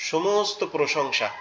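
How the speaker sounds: ordinary talk, nothing marked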